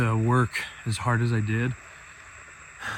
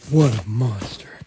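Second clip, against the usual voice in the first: normal talking voice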